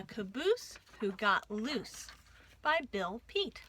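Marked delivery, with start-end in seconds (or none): none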